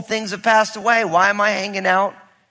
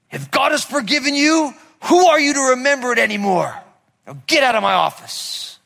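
raised, stern rebuke from the pastor; a mock Irish pastor scolding, higher; retold angry pastor; 7 semitones higher, 3 dB louder than usual. Pastor throws the young man out of his office, stern and raised. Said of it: "I saw him get right angry".

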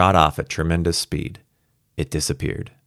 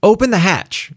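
urgent raised shout; 12 semitones higher, 4 dB louder than usual. Acted. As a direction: yell it, desperate to get out. Kramer urging crew to open hatch.

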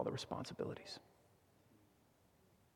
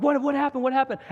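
much higher, louder alarmed calling out; 11 semitones higher, 5 dB louder than usual. Retold or imitated. Son racing across the house to his yelping dad, alarmed. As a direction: call out in a panic while running. Running toward dad's yelp.

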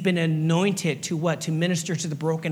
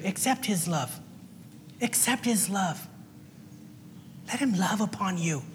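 softer, gentle invitation; tender appeal; 9 dB quieter than usual. Real, not acted. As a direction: coax them softly to accept.

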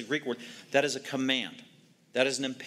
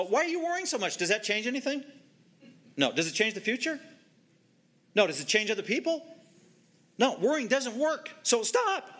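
high, rising rhetorical questions; Jesus, urgent; 8 semitones higher than usual. Acted. Preacher voicing Jesus to the congregation, high insistent questions. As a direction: press each question up high, then snap out stop. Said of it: "That's verse twenty-five and twenty-eight".

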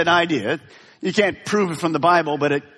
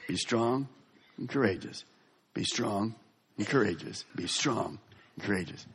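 softer with each repeat, lower, fading almost to a hush; fading gentle command; 6 semitones lower, 10 dB quieter than usual. Acted. God reassuring fearful Joshua, fading to a hush. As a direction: let each repeat grow quieter and tender.

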